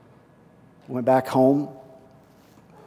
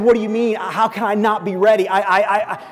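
higher, louder, stammering disbelief; stunned protest; 8 semitones higher, 5 dB louder than usual. Retold or imitated. Young pastor protesting to the senior pastor, stammering and stunned. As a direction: sputter it out, hurt and unbelieving. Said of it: "I said".

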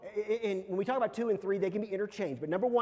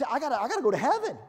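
fast, loud, higher urgent plea; frantic kid; 5 semitones higher, 6 dB louder, much faster than usual. Retold or imitated. Kid pressing unhurried parents, frantic mock urgency. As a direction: rush it out in mock desperation.

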